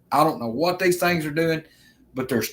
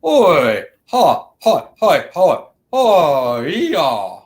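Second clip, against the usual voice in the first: creature impression; 2 semitones higher, 6 dB louder, much slower than usual. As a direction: mimic a wild creature hollering in the woods. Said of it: "I'll try to do an impersonation of it".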